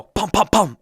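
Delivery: loud spoken thumps on a dash; imitated thumping. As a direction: imitate whacking a dashboard with punchy syllables. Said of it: "and I go".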